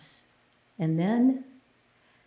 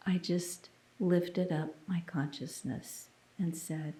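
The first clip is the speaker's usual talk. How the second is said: hushed whisper; 8 dB quieter than usual. Retold or imitated. Leading into her silent prayer.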